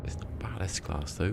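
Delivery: slow whisper to himself; hushed slow comment; whispered aside. Real, not acted. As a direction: breathe it out slowly, almost unvoiced. Muttering to himself while gaming.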